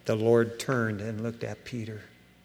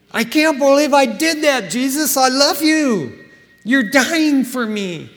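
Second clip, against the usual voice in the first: anguished cry; 11 semitones higher, 7 dB louder than usual. Acted. Peter's anguish voiced.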